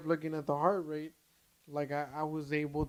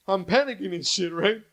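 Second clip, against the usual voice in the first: louder, worked-up retelling; animated retelling; 3 semitones higher, 8 dB louder than usual. Retold or imitated. Recounting the ER panic to his cohost, loud and worked up. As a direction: push it out like you're reliving the panic.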